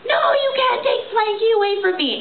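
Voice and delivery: put-on a four-year-old flipping out over losing the blankie; upset child. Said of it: "she was like".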